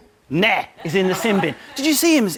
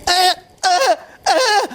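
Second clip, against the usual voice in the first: mock electrocution cries; 9 semitones higher, much slower than usual. Mocking Anthony in the chair with high jolting yelps. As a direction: yelp like you're being shocked.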